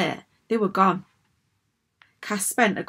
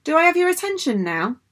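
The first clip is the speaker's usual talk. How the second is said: high, over-polite question; pointed politeness; 9 semitones higher, 4 dB louder than usual. A narrator's reading. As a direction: ask with exaggerated patience. Cass echoes principal's demand pointedly.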